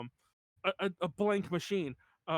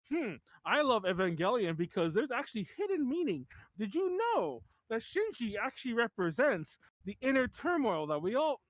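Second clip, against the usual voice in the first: mock pretentious fan. Mocking self-important Evangelion fans.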